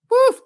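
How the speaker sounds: loud high-pitched woof yell; excited woof. Real, not acted. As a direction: let out a big high woof. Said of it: "I'm out of breath".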